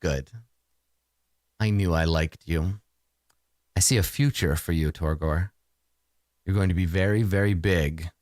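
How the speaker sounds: low, soft villainess voice; approving mistress. Acted. Mistress promising her favored minion a future, low and approving. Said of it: "She says".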